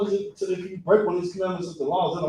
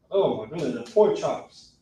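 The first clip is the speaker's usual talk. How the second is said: ordinary delivery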